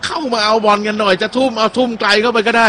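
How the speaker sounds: mock call to come get the ball; Abdulhamid calling out. Commentator voices Abdulhamid urging teammates up, a light mock call. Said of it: "อับดุลฮามิดบอก".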